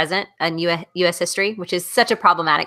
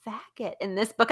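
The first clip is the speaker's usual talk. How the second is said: hushed report; 4 semitones higher, 10 dB quieter than usual. Retold or imitated. Daughter quietly reporting a slur.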